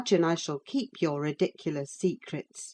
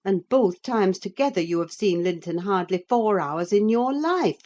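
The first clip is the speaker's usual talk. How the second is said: higher, louder mocking scorn; scornful nurse; 4 semitones higher, 4 dB louder than usual. A narrator's reading. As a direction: scoff at the very idea. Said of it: "as scornfully as I could utter".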